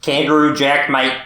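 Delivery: put-on hammy Aussie accent; Australian accent. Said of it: "our terrible Australian accents".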